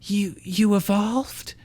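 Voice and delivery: slow, high, breathless surprise; disbelieving, halting; stunned. Acted. Iris stunned by Haxorus.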